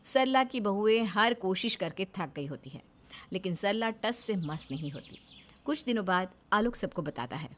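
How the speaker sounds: calm storyteller reading a Hindi serial; low, soft narrator voice; story narration. A narrator's reading. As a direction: narrate the scene evenly, setting it up.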